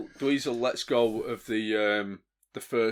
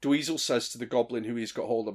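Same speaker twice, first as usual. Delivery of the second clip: usual speaking voice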